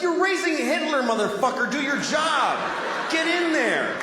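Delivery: high shouted mock scolding; mock yelling. Comic telling the weak mom off, shouted high mock scolding. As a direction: bark orders at a lazy parent.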